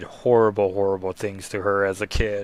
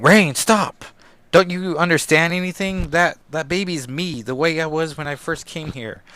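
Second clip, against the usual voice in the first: Ilyana's voice; 6 semitones higher than usual. Retold or imitated. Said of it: "Ilyana has to explain".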